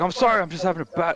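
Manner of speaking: high, worked-up apology; mock tantrum. Rehab patient excusing his outburst, mock tantrum. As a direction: imitate a frustrated kid protesting. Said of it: "He had this little tantrum".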